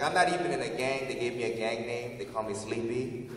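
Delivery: usual speaking voice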